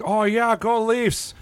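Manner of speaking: high, mock-excited fan cheer; a high, silly impression of a cheering Toronto fan; mocking Leafs fan. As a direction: ham up a gloating rival fan. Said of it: "And every time the Leafs was like".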